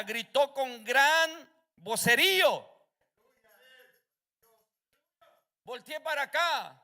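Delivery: loud, rousing sermon delivery; fiery preaching. Acted. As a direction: preach full force to the church. Preaching at full force. (predicación a todo pulmón)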